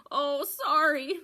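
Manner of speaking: hammed-up protective goat; Mister Goat voice. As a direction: pull the box away, apologetic. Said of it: "Mister Goat wound his front legs".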